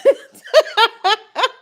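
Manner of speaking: high, loud burst of laughter; hearty laugh. Real, not acted. Recalling days of innocence on airplanes, laughing hard. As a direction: crack up loudly, high-pitched.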